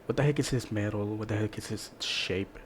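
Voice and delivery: ordinary talk, nothing marked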